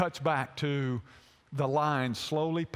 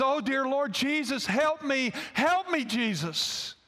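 high-pitched retold cry for help; desperate plea; 8 semitones higher than usual. Mother holding up her child before the lions, desperate plea. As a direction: plead higher and higher, then drop on the name.

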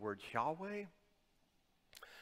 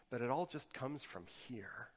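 breathy stage whisper; a confiding pulpit whisper. Acted. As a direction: lean in and whisper it. Aside on human voices.

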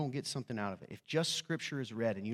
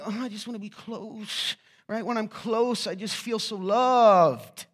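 lovestruck partner; 8 semitones higher, 8 dB louder, slower than usual. Retold or imitated.

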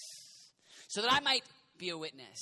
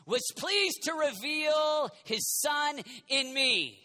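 shouted preaching, higher, drawn out; 9 semitones higher, 8 dB louder, slower than usual. Acted. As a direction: build the sermon to a peak. Preaching build.

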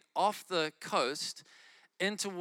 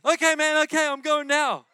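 higher, louder eager reply; eager, higher caller voice; 10 semitones higher, 11 dB louder than usual. Retold or imitated. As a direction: hurry to agree. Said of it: "caller agreeing to go".

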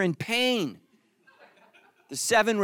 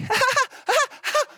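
much higher lone laugh in the corner. Retold or imitated. Wife laughing alone at the flopped joke, high rising laugh. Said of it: "she was kinda like".

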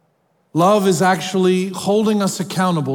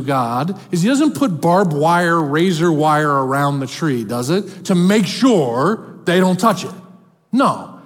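ordinary talk

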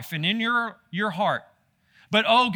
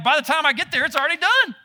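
higher, louder, playing innocent midwife; midwife excuse; 7 semitones higher, 4 dB louder, faster than usual. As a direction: toss off the excuse to Pharaoh, higher. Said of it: "what they told Pharaoh was like".

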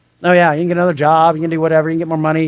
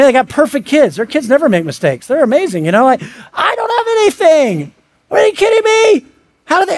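rising higher into an incredulous laugh; mock envy; 7 semitones higher than usual. Preacher imitating someone envying others' perfect lives online, climbing high with a laugh. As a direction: gush with growing disbelief.

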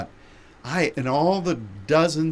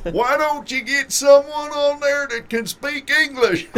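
put-on Texas accent yell; 13 semitones higher, 4 dB louder than usual. Old guy heckles the announcer, loud high Texas accent yell. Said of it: "bad Texas accent".

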